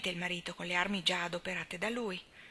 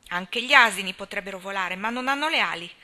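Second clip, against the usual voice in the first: put-on indignant old spinster; 9 dB louder than usual. A narrator's reading. Miss Cornelia confronting Anna about the operation, loud and alarmed. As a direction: burst in scandalised and loud.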